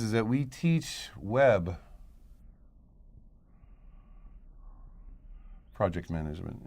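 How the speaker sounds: emphasize "web" to set it apart from plain project management; stress on web. Shown as a demonstration. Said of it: "the reason why I emphasize the word web".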